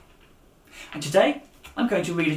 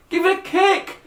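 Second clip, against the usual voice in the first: high urgent shouted order; trapped man shouting an order; 7 dB louder, slower than usual. Acted. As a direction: yell at the boy to kick. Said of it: "shouted the spiky-haired man".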